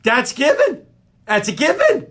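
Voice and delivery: high, insistent repetition; exasperated. Acted. Host insists about his list, exasperated.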